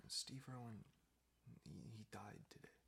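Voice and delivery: hushed, faltering near-whisper; imitating a mother softly announcing a death; breaking bad news. Mom tells child of death.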